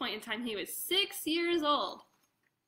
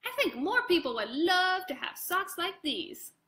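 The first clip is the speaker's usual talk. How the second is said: a hint of a higher kid voice; lightly voiced boy; 5 semitones higher, 3 dB louder than usual. Sebastian telling his parents his idea, lightly voiced kid. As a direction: read it like an eager little boy.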